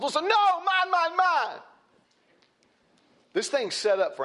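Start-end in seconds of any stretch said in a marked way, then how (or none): 0.0-1.6 high, whiny mock of a selfish person, climbing on mine; mocking greedy voice. Preacher mocking selfish people to congregation, whiny high voice. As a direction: sneer it out like a spoiled child grabbing. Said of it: "mock greedy voice again".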